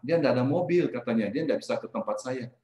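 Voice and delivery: ordinary delivery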